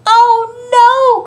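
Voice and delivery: high, loud cry of alarm; big storybook "oh no"; dismayed cry. A narrator's reading. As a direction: wail it as a little mouse in dismay. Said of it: "cried Little Mouse".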